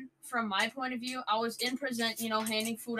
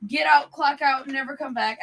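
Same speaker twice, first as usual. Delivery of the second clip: slightly raised voice imitating a yell; louder at first, then easing off; retold yelling; 4 semitones higher, 5 dB louder than usual. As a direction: relay the boss's yell, half-strength. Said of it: "Carrie starts yelling at her".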